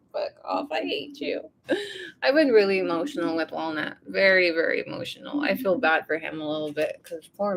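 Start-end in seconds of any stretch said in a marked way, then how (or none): 0.1-1.4 high, soft, whimpering retort; retold teary outburst, small high voice; mock tearful outburst. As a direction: whimper it softly, imitating a tearful sulk. Acts out own tearful outburst at partner.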